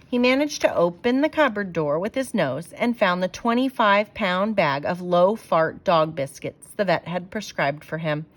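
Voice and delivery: usual speaking voice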